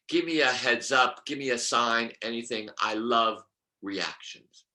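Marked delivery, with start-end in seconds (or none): none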